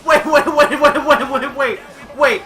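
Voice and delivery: high, loud, rapid-fire disbelief; yelling wait over and over, laughing through it; shocked excitement. Real, not acted. Streamer yelling at a surprise wrestling run-in, excited.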